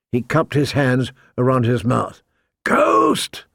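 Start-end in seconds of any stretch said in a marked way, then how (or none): 2.6-3.4 high, drawn-out call; shout. A narrator's reading. Man shouts for his missing wolf, high-pitched call. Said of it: "he shouted".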